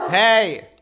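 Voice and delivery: loud sharp hey; shouting. Real, not acted. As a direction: yell at the dogs. Shouting at dogs.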